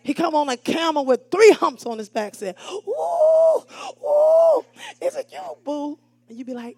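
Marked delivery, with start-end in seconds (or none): 2.7-6.0 much higher, squealing mock greeting; lightly voiced excited woman. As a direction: coo at the man like a thrilled girlfriend. Woman greeting man on camel.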